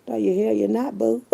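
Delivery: ordinary delivery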